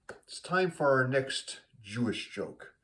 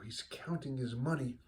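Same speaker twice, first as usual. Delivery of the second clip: ordinary talk, nothing marked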